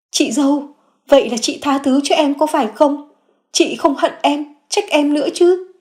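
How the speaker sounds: high, eager pleading voice; Phượng hoping her sister-in-law forgives her, high voice; hopeful sister-in-law. A narrator's reading.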